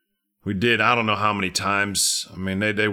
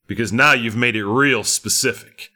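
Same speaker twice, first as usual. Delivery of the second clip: ordinary talk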